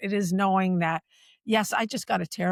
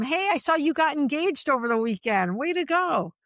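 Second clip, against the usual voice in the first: bright, higher-pitched pat on the back; cheerful congratulation; 7 semitones higher than usual. Retold or imitated. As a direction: congratulate a colleague warmly in passing. Voicing a hallway congratulation.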